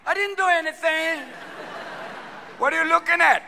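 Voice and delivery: high, mock-innocent protest; passive-aggressive. Soccer player after sly fouls, passive-aggressive innocence. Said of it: "it's a little passive-aggressive".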